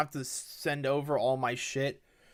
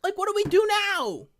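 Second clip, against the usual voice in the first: high, quick, helpless; exasperated whine; 14 semitones higher, 4 dB louder, faster than usual. Real, not acted. Streamer asking chat, high and exasperated. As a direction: throw up your hands.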